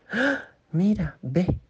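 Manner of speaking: put-on high excited child calling look; be a small child who has just spotted something wonderful; high childlike cry of look at that; amazed child. Shown as a demonstration. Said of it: "cómo es un niño así como".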